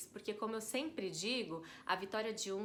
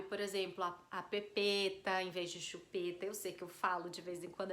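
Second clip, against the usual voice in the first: ordinary delivery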